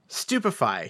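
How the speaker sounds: normal talking voice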